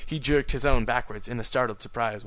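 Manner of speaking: plain reading voice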